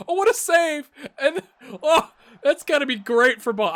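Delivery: high, loud gasping oh's; loud, high near-miss excitement; excited yelling. Real, not acted. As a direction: yell as the chance nearly goes in. Near miss and big save.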